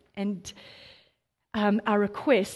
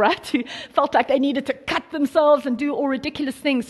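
laughing mockery; 4 semitones higher, 5 dB louder than usual. Real, not acted. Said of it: "jolly prophets of Baal".

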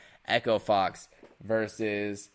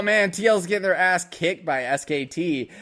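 raised, loud gleeful exclamation; mock excited reaction; 7 semitones higher, 6 dB louder than usual. Imagined onlookers seeing a team beaten, loud mock excitement. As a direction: crow it like a thrilled onlooker.

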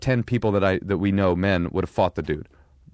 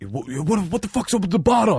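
mock aggressive runner; 11 semitones higher than usual. Angry runner confronting him.

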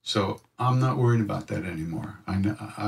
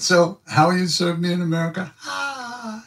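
much higher, teasing guru voice; Maharaji's voice; 9 semitones higher than usual. Retold or imitated. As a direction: tease her playfully as the guru. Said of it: "He said".

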